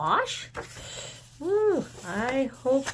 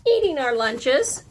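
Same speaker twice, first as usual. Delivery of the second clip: put-on loud, higher kid's reply; matter-of-fact child; 4 semitones higher, 7 dB louder than usual. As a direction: answer like it's obvious. The child answers.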